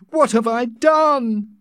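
loud, high anguished lament; crying out. A narrator's reading. Savage blaming himself for Linda's death, anguished. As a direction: wail it slowly in despair. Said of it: "began crying loudly".

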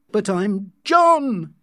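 high, drawn-out angry protest; angry shout. A narrator's reading. John to Linda who fails to know him, angry shout. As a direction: raise your voice in angry frustration. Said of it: "he shouted angrily".

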